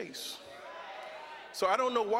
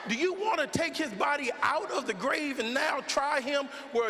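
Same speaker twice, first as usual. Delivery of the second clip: preaching at full force; 4 semitones higher than usual. Real, not acted. Preacher challenging the crowd over an actor's case, in full preaching voice. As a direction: hammer each question at the crowd.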